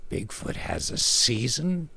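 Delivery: a quiet, slow, mildly disbelieving question; lightly voiced disbelief, not quite buying it; incredulous. Husband doubting his wife's Bigfoot season, mildly incredulous. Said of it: "I asked incredulously".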